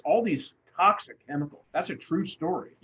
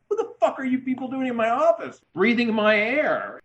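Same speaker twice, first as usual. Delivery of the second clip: hammed-up indignant old boss, higher, half laughing; mock outrage; 8 semitones higher than usual. Author voices Bugatti fuming at visitors in his office, mock outrage.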